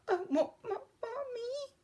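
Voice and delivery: high, stammering whimper; tearful duckling. A narrator's reading. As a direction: whimper for mother in a tiny, breaking-up stutter. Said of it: "fat tear rolled down his bill".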